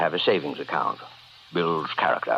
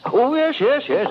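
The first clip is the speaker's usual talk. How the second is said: flustered; 3 dB louder than usual. Acted. Startled employer covering suspicion.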